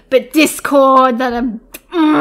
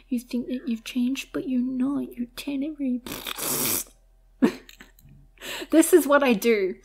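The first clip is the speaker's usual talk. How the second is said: ordinary delivery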